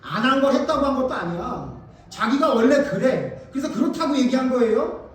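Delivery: loud, high insistence; full-force preaching. Real, not acted. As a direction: hammer the point home from the pulpit.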